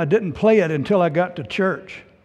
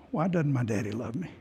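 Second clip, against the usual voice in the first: hurt question; 5 semitones lower, 7 dB quieter than usual. Retold or imitated. Retelling crying alone in bed.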